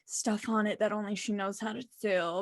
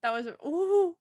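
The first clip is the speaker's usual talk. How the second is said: choked up; 4 semitones higher than usual. Real, not acted. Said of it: "let me calm down a bit".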